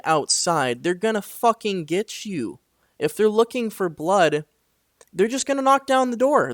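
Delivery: high, loud shouting back in disbelief; retold angry yelling. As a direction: yell it like the danger is at the door.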